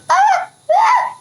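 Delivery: high startled shrieks at a bug; real little scream of fright. Real, not acted. Scared by a bug.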